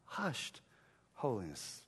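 soft, dropping low; mock-reverent near-murmur; hushed. Shown as a demonstration. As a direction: say it in a hush. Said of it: "not hushed".

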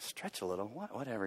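low, soft dismissive mumble; muttering whatever to his son. Retold or imitated. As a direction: brush it off, unimpressed. Dad brushing off son.

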